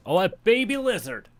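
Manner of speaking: ordinary talk, nothing marked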